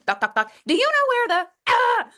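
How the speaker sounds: high, intrusive pestering voice; pestering interrupter. Acted. Someone knocking while she works, pestering. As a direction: burst in with a high, needy question. Acted interruption at office door.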